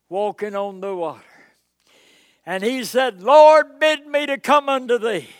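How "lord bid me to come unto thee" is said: called out high and loud to the Lord; pleading call. Acted. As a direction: Peter begging from the boat, ringing out. Peter calls to Jesus on water.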